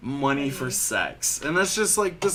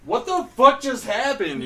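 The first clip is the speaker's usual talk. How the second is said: high-pitched mock disbelief; high, incredulous retold question; retold bewildered friend; 10 semitones higher, 4 dB louder than usual. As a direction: imitate a baffled friend, pitched up. Friend's shocked reaction, retold.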